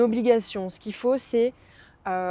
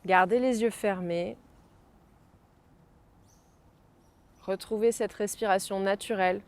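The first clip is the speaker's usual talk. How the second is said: guided meditation. Acted. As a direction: gently bring them back. Guides out of the exercise. (voix douce de méditation guidée)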